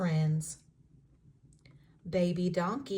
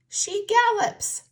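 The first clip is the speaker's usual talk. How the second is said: excited emphasis; 13 semitones higher, 9 dB louder than usual. A narrator's reading. Lively story reading.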